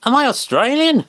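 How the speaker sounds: as if the idea is absurd; incredulous question. Real, not acted. Reacting to chat's odd question.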